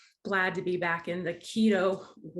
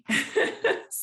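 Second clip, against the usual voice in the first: high giggle; 13 semitones higher, 4 dB louder than usual. Real, not acted. Laughing about birthday treat.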